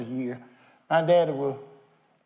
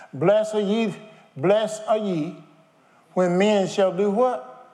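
preaching at full force; 5 semitones higher, 4 dB louder, slower than usual. Acted.

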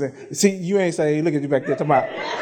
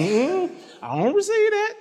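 retold refusal; 11 semitones higher than usual. Retelling his refusal of bad prophecy.

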